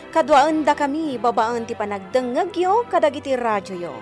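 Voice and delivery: normal talking voice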